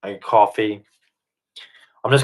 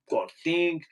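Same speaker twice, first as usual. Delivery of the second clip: muttered, much softer mock pondering; mock Jordan Peterson; 5 semitones higher, 10 dB quieter than usual. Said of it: "It's like Jordan Peterson".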